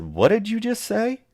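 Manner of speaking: high, sharp startled question; jolted awake by what you heard; startled disbelief. Retold or imitated. Startled, shaking sleeping daughter awake.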